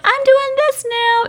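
high, chirpy mocking voice; mock breezy ex. Host mimicking the ex-girlfriend at the door, high and chirpy. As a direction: sing it out with fake cheer. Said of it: "was like".

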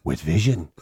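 a hint of a lofty sage stating the obvious; mock Dalai Lama wisdom. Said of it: "who say it like it means something".

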